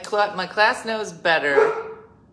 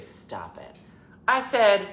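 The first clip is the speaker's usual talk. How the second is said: normal talking voice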